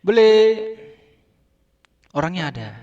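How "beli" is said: short, loud, high beli; shouted call. Retold or imitated. Shouting for the owner at a new shop, loud and high. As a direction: bark it out to the shop. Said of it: "Kembali lagi gua teriak".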